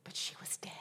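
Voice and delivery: whispered reveal; hushed. Real, not acted. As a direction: drop to a whisper for the sad ending. Sad punchline of story.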